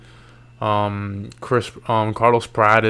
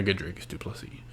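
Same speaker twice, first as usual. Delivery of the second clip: fast hushed aside; quick whisper; 4 semitones lower, 13 dB quieter, faster than usual. Real, not acted. Aside to viewers about a matchup.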